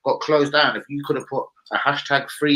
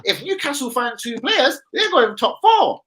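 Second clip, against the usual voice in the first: much higher put-on Geordie accent; 12 semitones higher than usual. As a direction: keep the high Geordie voice going. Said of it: "forget now the accents".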